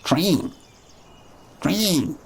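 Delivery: "train" squeezed toward "treeng"; mock "train" as "treen"; mocked mispronunciation. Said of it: "You probably say it like T R E E N".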